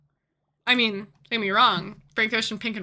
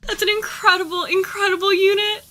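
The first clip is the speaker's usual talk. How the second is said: delighted; 9 semitones higher, 4 dB louder than usual. Real, not acted. Delighted by the joke.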